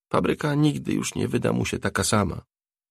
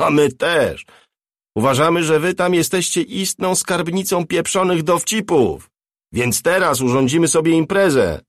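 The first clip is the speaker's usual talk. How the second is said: louder and higher, sneering through a loudspeaker; amplified voice; 9 semitones higher, 6 dB louder than usual. Acted. Attackers threatening the crew over a loudspeaker, loud and sneering. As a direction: threaten them over a megaphone. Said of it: "Słyszeli ten sam wzmocniony głos".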